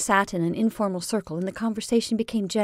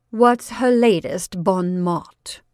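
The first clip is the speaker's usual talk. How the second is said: mocking; 6 dB louder, slower than usual. Marjorie mocks Bernice.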